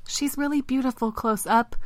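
high, smitten little frog; dreamy high frog voice; croaking frog. Acted. Vince gazing back at the princess after his kiss, croaking. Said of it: "croaked Vince".

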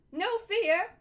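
high, loud cry; loud, perky jackdaw. A narrator's reading. Perky jackdaw blurting into the silence, loud and high. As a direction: pipe up loudly like a perky bird. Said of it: "added in a loud voice".

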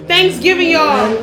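high, loud announcement to everyone; loud call-out. Real, not acted. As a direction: call it out over the room. Calls out the holiday after apologizing.

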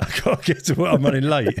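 laughing as you repeat a silly excuse; laughing mimicry. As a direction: echo the excuse through giggles. Laughing along at mate's mock excuse.

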